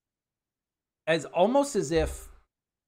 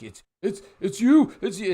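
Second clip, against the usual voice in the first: high, loud stammering panic; panicked; 6 semitones higher, 7 dB louder than usual. Acted. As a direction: blurt it out in sudden terror. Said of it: "has this look of panic on his face".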